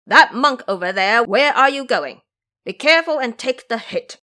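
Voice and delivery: loud, sharp shouted challenge from the river monster; shouting challenge. A narrator's reading. Monster shouts at Ba Jie as he pushes through the water, loud and challenging. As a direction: bellow it at the intruder, daring him on. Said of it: "shouted to his face".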